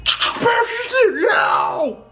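high, slow, hammy sales yell; pushy sales shout. Shown as a demonstration. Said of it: "so I'm not like".

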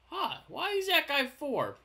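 sudden high surprised question; puzzled jump in pitch at the mistake; surprised huh. Real, not acted. Building in Minecraft, spots a wrong count.

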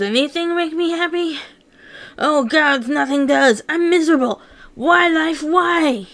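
despairing outburst. Acted. Manic alone, crying out in despair. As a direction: pour out the misery.

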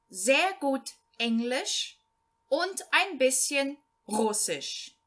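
slow, clear German read-aloud, each phrase set apart; slow learner reading. Shown as a demonstration. Teacher reading a German text to Portuguese-speaking beginners, slow and clear. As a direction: read it out slowly so beginners can follow.

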